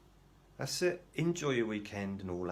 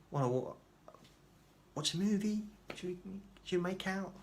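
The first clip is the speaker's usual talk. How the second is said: high, incredulous mock offers; mock suggestions; 12 semitones higher, 3 dB louder than usual. Patient baffled by psychiatrist's question, mockingly suggesting dates. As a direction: pitch absurd ideas up high, as if baffled.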